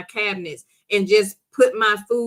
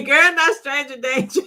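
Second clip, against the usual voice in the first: higher, talking through laughter; laughing; 4 semitones higher, 3 dB louder than usual. Real, not acted. Host reacting to Cece's stranger danger comment, laughing. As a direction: laugh through the words in amused disbelief.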